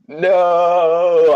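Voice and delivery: loud cry of despair; anguished shout. Acted. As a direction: scream no in anguish. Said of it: "dropped to my knees and shout".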